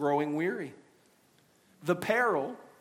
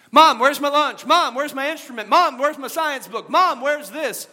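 put-on loud, higher kid calling out; yelling across the room; 7 semitones higher, 7 dB louder than usual. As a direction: call out for mom from another room. Kids calling for mom.

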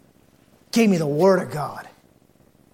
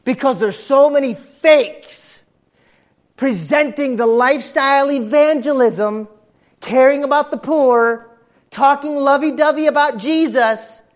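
full-force preaching; 9 semitones higher, 8 dB louder, slower than usual. Acted.

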